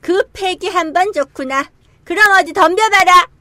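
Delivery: fierce challenge. Acted. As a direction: roar the dare. Wukong dares the demon king. (높고 크게 외치는 도발)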